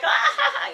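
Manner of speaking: loud high burst of laughing; loud high laughter on a wild car ride. Retold or imitated. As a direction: laugh like on a roller coaster. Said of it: "and I'm laughing".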